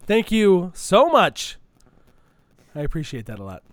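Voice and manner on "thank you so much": slow, loud, high gushing thanks; exaggerated thanks. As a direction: gush your thanks slowly.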